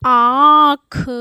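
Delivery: आँख loud, with a very long nasal आँ; nasalised vowel demo. Shown as a demonstration. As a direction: stretch आँख loudly through mouth and nose. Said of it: "ये क्या उच्चारित होगा?".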